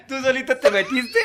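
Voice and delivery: loud teasing laugh through the words; laughing so hard it nearly breaks into crying; laughing while talking. Real, not acted. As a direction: crack up while teasing him.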